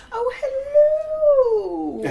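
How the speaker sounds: very high, loud, long-drawn warble; Mrs Doubtfire. Acted. Host imitating a favourite film scene, high hammed-up old-lady hoot. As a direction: sing out a hammed-up greeting, hugely stretched. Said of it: "Oh my God, Missus Doubtfire".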